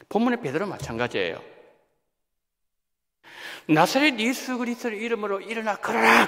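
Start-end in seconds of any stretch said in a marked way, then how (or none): 3.7-6.3 higher, ringing call of the name; proclaiming shout. Retold or imitated. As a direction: cry it out like Peter commanding. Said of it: "외친 것밖에 없어요".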